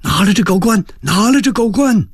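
high-pitched crowd chant; crowd clamour. A narrator's reading. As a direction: cry out like an agitated mob. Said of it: "前挤后压的鼓噪起来". (人群高声鼓噪)

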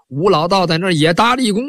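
plain reading voice

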